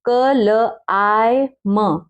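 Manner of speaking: sounds drawn out one by one, very slow; बहुत धीरे आवाज़ें अलग करना; very slow spelling. Shown as a demonstration. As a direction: break it into its sounds slowly. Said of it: "climb".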